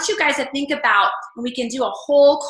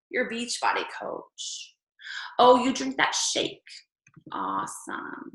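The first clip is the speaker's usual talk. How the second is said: mild mock sarcasm; 6 dB quieter, slower than usual. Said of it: "people that say things to your face".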